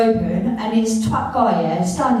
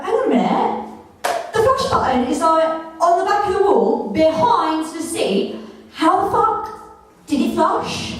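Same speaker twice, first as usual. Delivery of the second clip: higher, voice thrown up in startled confusion; alarmed disbelief; 7 semitones higher than usual. Retold or imitated. After the toilet flushes on its own, high startled disbelief. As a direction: squeak it out, baffled and spooked. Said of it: "I was like".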